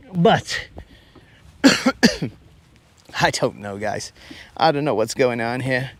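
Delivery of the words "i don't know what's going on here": normal talking voice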